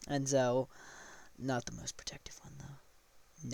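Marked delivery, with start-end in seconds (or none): 1.4-3.5 quick, hushed whisper; whispered aside. Real, not acted. Storyteller qualifies who is protective, quick whispered aside. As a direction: slip it in under your breath, quickly. Aside correcting what was just said.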